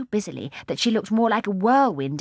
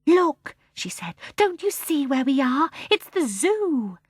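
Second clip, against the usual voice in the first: excited; 7 semitones higher, slower than usual. Acted. Jane realising they're at zoo.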